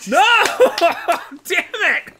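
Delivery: loud, high laughing yell of defeat; frustrated yelling. Real, not acted. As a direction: shout in real frustration.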